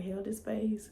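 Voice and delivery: normal talking voice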